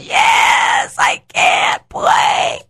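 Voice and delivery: put-on breathless whispered little boy, slow at the end; scared child. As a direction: whisper it like a frightened boy frozen before his first game. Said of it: "Are you afraid?".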